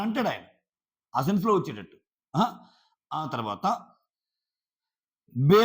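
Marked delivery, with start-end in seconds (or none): 5.3-5.6 a sharp, loud "బే" thrown out in anger; angry retort. Retold or imitated. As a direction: bark "బే" like he did in the fight. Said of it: "కోపంలో వచ్చింది". (కోపంగా గట్టిగా "బే")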